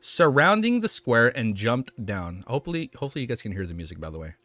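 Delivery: steady storytelling read; story narration. A narrator's reading. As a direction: read the chapter to viewers. Reads novel chapter aloud.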